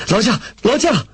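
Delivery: high-pitched urgent call; urgent shout. A narrator's reading. Lei Feng pushing through a station crowd, urgent shout. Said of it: "雷锋大喊着".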